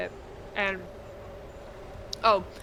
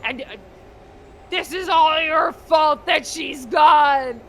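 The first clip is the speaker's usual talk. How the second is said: loud, high, hurtful rage; bitter shouting dad; 6 semitones higher, 6 dB louder than usual. Retold or imitated. A father blaming his son for the mother's absence, shouting. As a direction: shout the blame at him, slowing at the end. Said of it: "And the guy's like".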